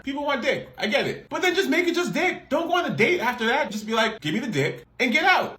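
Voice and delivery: high-pitched exasperated rant; incredulous rant. Real, not acted. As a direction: pitch up and vent in disbelief.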